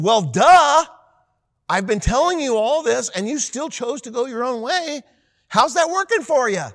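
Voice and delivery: high, loud sarcastic jab; mock them for choosing wrong, high and loud; sarcastic duh. Wisdom mocking the clueless rebels, sarcastic and loud. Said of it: "almost a comical aspect to it".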